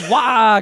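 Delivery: high, loud mock yell; imitated yell. Correcting the subtitle, loud mock yell of the melting Kars. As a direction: yell it like a cartoon villain. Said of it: "He's going".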